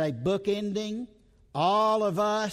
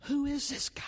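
breathy whisper of wonder, higher; awed whisper; 5 semitones higher, 5 dB quieter than usual. Acted. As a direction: whisper it like a secret marvel. Preacher wonders aloud about servant.

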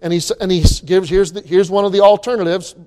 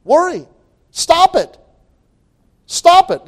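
sharp command; 9 semitones higher than usual. Acted. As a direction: bark it like a stop sign. Preacher commanding listeners to stop worrying.

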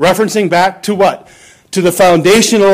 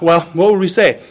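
ordinary talk

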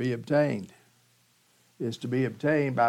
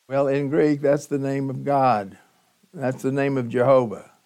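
usual speaking voice

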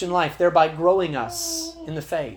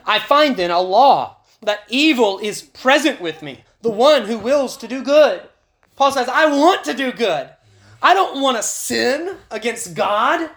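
higher and louder, pleading as Paul; preacher voicing Paul's anguished wish; impassioned Paul; 5 semitones higher, 5 dB louder than usual. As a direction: cry out Paul's longing to do good.

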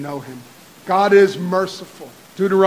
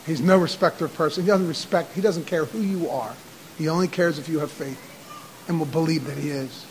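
normal talking voice

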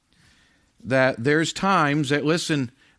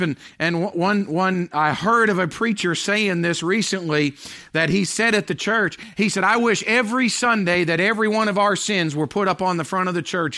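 preaching at full force; 9 semitones higher, 6 dB louder than usual. Acted. Pastor preaching hard to the congregation, full force. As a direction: drive it home from the pulpit.

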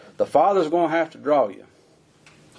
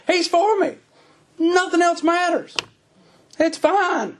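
fervent cry; 13 semitones higher, slower than usual. Real, not acted. Preacher's climax of fervent confidence.

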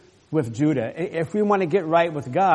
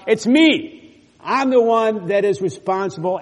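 higher and louder; emphatic; 5 semitones higher, 6 dB louder than usual. Acted. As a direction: declare it's you. Preacher's emphatic climax.

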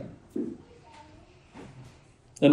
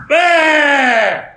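loud high mock wail; angry baby wail; 10 semitones higher, 6 dB louder than usual. Preacher imitates a baby's angry cry, loud high mock wail. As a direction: bawl it like a furious baby. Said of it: "suddenly becomes".

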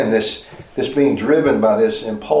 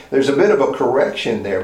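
normal talking voice

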